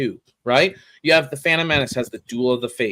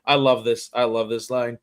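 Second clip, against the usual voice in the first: usual speaking voice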